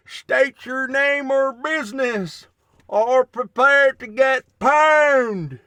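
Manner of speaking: high, loud, slow hammed-up guard challenge; gate guard. Playing with the lantern, a sentry challenges a stranger. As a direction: bellow like a castle sentry, ending in a shout.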